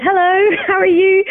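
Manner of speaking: high-pitched, chirpy hello imitating the pastor; pastor's cheery greeting. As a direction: greet a newcomer in a lifted, singsong imitation.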